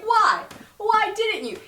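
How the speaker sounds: mock demanding. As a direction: push hard, hurt and angry.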